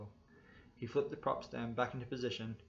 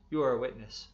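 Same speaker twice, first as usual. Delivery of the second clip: loud cop; 10 dB louder than usual. A narrator's reading. Cop barking at passenger.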